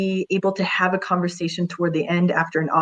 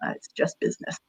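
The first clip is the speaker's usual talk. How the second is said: whispered aside; 3 semitones lower, 11 dB quieter than usual. Real, not acted. Final deadpan remark.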